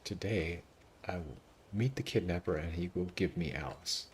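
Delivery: low, soft mock recitation of a narrated line; a hint of deadpan narrator parody; mocking game narrator. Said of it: "kind of a terrible line".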